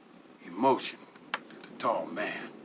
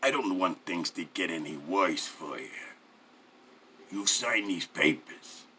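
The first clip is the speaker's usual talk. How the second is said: low threat; 5 semitones lower than usual. A narrator's reading. Mafia man inches from captive's face, low menacing persuasion.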